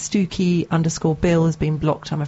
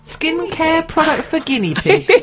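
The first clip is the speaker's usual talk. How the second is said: high, slow, disbelieving question; incredulous; 10 semitones higher, slower than usual. Real, not acted. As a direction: say it like you can't believe it. Host reads surprising business niche.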